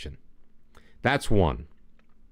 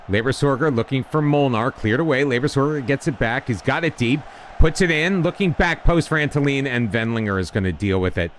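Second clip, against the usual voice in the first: play-by-play; 4 semitones higher than usual. Acted. Calling the match live.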